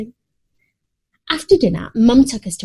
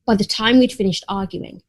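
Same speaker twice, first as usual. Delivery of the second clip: plain reading voice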